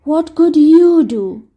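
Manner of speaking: slow, downcast question; a hint of hopeless sadness; deeply depressed. Humpty Dumpty doubting Dick, mildly gloomy. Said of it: "in deep depression".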